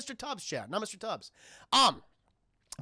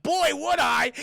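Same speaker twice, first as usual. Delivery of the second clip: high, loud, eager reply; eager acceptance; 8 semitones higher, 7 dB louder, slower than usual. Retold or imitated. Tuco eagerly accepts the offered snuff box, excited. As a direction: jump at the offer with gusto.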